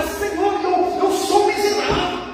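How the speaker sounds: high-pitched cry to God; crying out, acted. Believer confessing misery to God, acted crying out. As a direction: clamour to the Lord in confession. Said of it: "uma vida de clamor".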